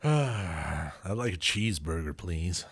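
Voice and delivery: slow, careful American accent. Shown as a demonstration. Put on the spot by the co-host, American accent. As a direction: put on your best American, unhurried. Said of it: "Give me your best American accent".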